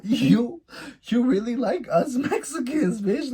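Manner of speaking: high-pitched incredulous teasing; amused disbelief. Retold or imitated. Teasing date about his dog's name, high-pitched disbelief. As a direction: laugh into a high, astonished jab.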